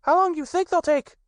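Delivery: put-on very high, eager child voice; little Roo chattering. A narrator's reading. Little Roo pesters his friends about their sticks, high and excited. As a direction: tumble the questions out like an impatient little one. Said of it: "asked Roo".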